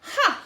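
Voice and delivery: sharp loud high ha; snort. A narrator's reading. McGreely gloating at the bunnies, loud snorted ha. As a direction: bark a triumphant ha. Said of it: "he snorted".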